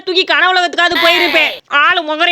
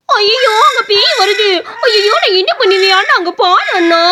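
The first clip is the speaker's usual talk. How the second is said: high cartoon bawling with leaping pitch; terrified wail; 5 semitones higher, slower than usual. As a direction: cry out to brother in hammed-up panic. Sees ghost coming, cries out. (பயத்தில் அலறி அழும் குரல்)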